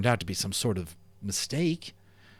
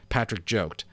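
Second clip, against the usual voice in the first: ordinary delivery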